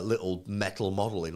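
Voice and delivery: ordinary talk, nothing marked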